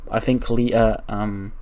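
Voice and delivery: ordinary talk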